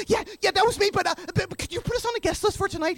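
high, hurried, stammering excitement; breathlessly keen fan, re-enacted; eager. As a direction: trip over your words asking a big favour. Eager request to rock star.

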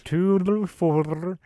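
slow, warbling underwater voice; bobbing in water. Pretend. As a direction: talk like you're underwater. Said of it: "We have to talk like we're underwater".

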